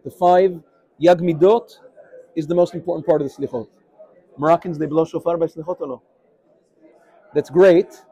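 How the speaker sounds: normal talking voice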